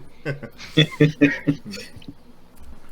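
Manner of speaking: loud breathy laughter; hearty laugh. Real, not acted. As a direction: laugh it out freely. Laughing at Jamaican accent joke.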